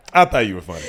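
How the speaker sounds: low retold jab with "I" emphasized; stress on I. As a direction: put the weight on "I", then fall away. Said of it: "Emphasis on the I".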